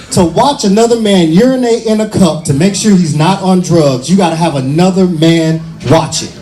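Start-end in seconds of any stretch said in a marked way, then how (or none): none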